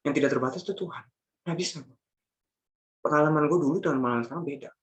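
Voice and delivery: usual speaking voice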